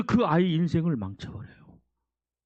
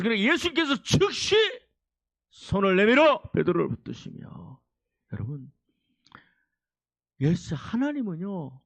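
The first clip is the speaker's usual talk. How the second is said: ordinary talk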